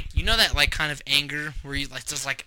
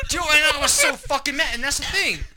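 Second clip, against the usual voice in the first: higher pitched, heated retelling; worked-up; 11 semitones higher than usual. Real, not acted. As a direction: pitch jumps up as the anger comes back. Retelling rage, voice jumps up.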